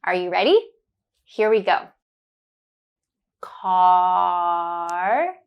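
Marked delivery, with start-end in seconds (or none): 3.4-5.4 "Car" with a long drawn-out ah; held ah. Shown as a demonstration. Teacher drawing out the ah in "Car" for learners. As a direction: stretch the ah at the end of "Car". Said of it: "To practice the ah sound".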